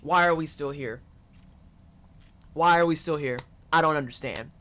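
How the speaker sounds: low, tired, baffled muttering; lightly voiced lost everyman; weary confusion. A hint of a frustrated man asking why they're still here. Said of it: "sitting there going".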